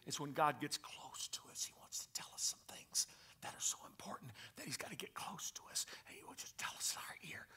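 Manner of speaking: hushed, confiding whisper. Shown as a demonstration. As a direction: whisper it close into the ear. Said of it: "in the whisper".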